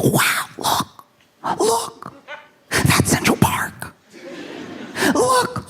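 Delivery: whispery, high-pitched awe; hammed-up breathless amazement; imitating excited girlfriend. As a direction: gasp out each sight in mock wonder. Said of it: "see how excited she is".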